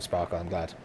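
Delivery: normal talking voice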